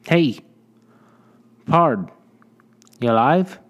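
a stranger hollering to a man lying still; calling out. Acted. Rescuer calling to a passed-out man, loud and rough. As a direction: shout it across the yard to wake him. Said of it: "It was getting louder".

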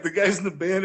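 ordinary talk, nothing marked

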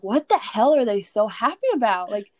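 high, loud incredulous outburst, pitch climbing; exasperated disbelief. Retold or imitated. Newcomer baffled by the happy old men, high exasperated outburst. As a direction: replay your own bewildered panic, half laughing at it.

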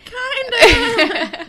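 high, loud, laughing; laughing Anya. Retold or imitated. Anya answering Wesley, laughing high. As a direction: squeak it out through a laugh. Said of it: "she's like".

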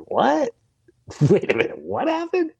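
high-pitched, incredulous double take; astonished disbelief. Retold or imitated. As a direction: act out a startled husband, voice shooting up. Acts out startled disbelief at wife.

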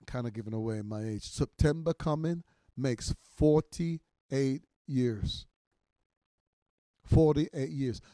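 loud, driving pulpit voice; full-force preaching. Acted. Pastor urging ministers to seek counsel, loud preaching.

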